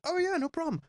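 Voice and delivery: imitating [name]. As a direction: give it like a relaxed rock star. [name] voiced in story.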